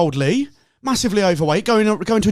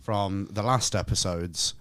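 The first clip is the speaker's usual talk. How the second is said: mock dramatic; 8 semitones lower, 6 dB quieter than usual. Real, not acted. Teasing big question.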